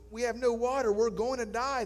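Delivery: high, loud panic; panicked. Acted. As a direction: cry out in despair. Panicking castaway.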